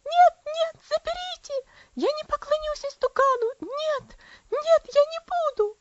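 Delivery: high, loud, frantic shrieking; a girl screaming in terror at a vision; wild scream. Acted. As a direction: cry out wildly in delirium. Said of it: "она дико закричала".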